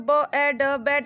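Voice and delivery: ব, এ, ড spoken separately, then blended into ব্যাড; sounding out letter by letter. Shown as a demonstration. Teacher to pupils, reading ব্যাড sound by sound. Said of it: "এরকমভাবে পড়তে পারো".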